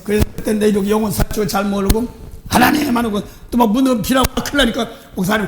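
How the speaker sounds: ordinary talk, nothing marked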